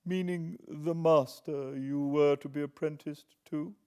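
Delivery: lightly voiced questioning old lady; a hint of an old woman probing. Miss Havisham questions Pip.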